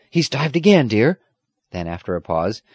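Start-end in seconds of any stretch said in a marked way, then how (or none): 0.1-1.2 raised, louder running report; excited. A narrator's reading. Squire telling granddaughter of the dive, excited. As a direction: keep the excited report going. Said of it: "almost as excited as she was".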